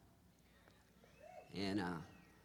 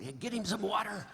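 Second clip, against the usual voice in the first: higher-pitched mock call for help; urgent calling out; 9 semitones higher than usual. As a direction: call out for someone to fetch water. Acting out someone calling for water.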